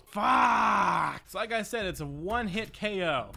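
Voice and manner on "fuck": loud angry swear; frustrated curse. Real, not acted. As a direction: spit it out in defeat. Dying to the boss.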